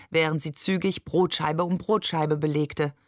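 plain reading voice

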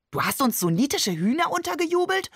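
high, climbing incredulous question; mock outrage. A narrator's reading. Shakir threatens Babe with a playful slap, mock-outraged. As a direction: pitch it way up in playful accusation. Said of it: "deutete eine Ohrfeige an".

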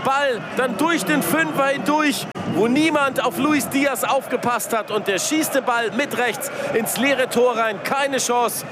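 high and elated, winding down; excited replay call. Real, not acted. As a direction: still buzzing after the goal. Replaying the goal, still elated.

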